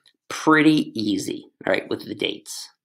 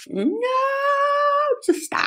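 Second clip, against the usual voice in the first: mock despair. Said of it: "And I'm like".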